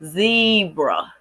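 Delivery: "zebra" drawn out as ZEE-bruh; long ZEE in "zebra", falling on bruh; syllable demonstration. Shown as a demonstration. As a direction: say "zebra" slowly in two syllables. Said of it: "There will be two syllables".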